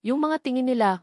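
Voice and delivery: usual speaking voice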